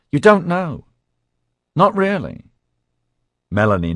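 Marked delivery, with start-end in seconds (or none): 0.1-2.4 high, loud, insistent anxious voice; anxious insistence. Acted. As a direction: push it high and worried.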